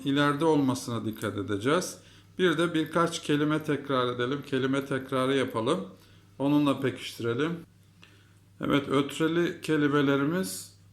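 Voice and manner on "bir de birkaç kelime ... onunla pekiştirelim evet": normal talking voice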